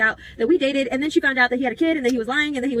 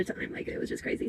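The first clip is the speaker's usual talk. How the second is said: whispering; 14 dB quieter than usual. Real, not acted. Whispered aside to the viewer.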